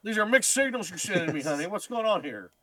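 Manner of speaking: hammed-up confused husband pleading; mock husband. Mocking the husband's lines.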